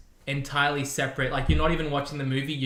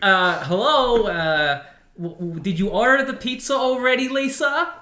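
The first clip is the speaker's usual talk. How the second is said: high, loud Tommy Wiseau voice; Tommy Wiseau impression; 9 semitones higher, 7 dB louder than usual.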